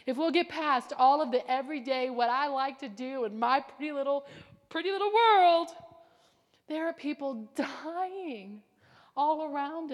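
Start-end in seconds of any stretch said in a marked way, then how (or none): 3.6-5.7 mocking. Mocking comfortable life.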